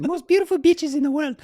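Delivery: very high, loud mock Italian accent; Simone Moro. Italian climber in the tent inviting Corey to Italy, high imitated voice. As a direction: play an Italian climber gushing in a high caricature. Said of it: "Simone has this voice that is just epic".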